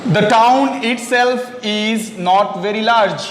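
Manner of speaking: each word spaced out slowly for the class; slow model sentence. Shown as a demonstration. Teacher reading the example sentence slowly to the class. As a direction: read it out slowly so students can follow. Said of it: "ये जो word है itself".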